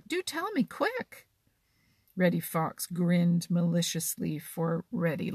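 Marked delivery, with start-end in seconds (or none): 0.1-1.3 higher, eager pleading little chuck. Acted. Johnny urging Reddy Fox to tell, higher and eager. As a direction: plead to hear it now. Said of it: "said Johnny Chuck".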